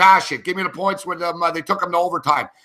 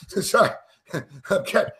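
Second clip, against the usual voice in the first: normal talking voice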